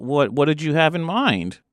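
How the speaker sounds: normal talking voice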